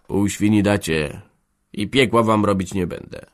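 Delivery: ordinary delivery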